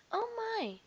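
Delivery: high startled gasp; shaken oh my. A narrator's reading. Faetua shaken after the elephant's blast, high startled. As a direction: knees still knocking.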